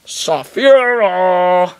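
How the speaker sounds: long loud slow call. Acted. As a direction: call across a distance. Calling the dragon.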